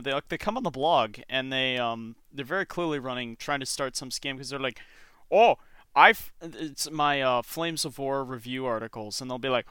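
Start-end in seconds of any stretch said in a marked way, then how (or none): 5.3-6.3 put-on Indian accent. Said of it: "your Indian accent".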